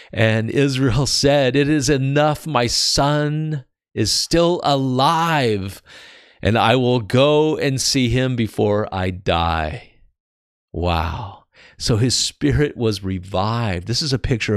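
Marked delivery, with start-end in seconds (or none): 0.1-9.9 slow, raised, emphatic Bible reading; scripture reading. Acted. Preacher reading Jacob's declaration about his son, slow emphatic reading. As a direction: draw out the joyful declaration.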